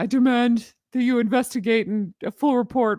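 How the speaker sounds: lightly mocking Prince Harry's demands; a hint of pompous prince. Mocking Harry demanding things of the police, lightly pompous. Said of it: "I can't do his accent".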